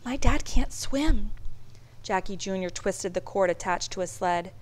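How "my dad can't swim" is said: put-on higher, slow, worried child; understated dread, pitched up; dawning fear. A narrator's reading. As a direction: say it slowly as the danger sinks in. Child realises Dad's danger.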